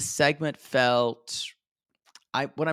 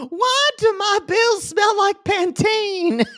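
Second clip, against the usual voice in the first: high, slow, louder mock whine; imitating Tater; 5 dB louder, slower than usual. Host plays Tater sniffing the bills, high drawn-out mock voice. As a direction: draw it out in a squeaky caricature. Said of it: "I wanna see Tater saying".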